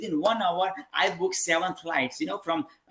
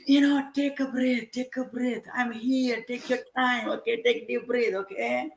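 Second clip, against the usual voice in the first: reassuring; 5 semitones higher than usual. Retold or imitated. Calming her on the phone.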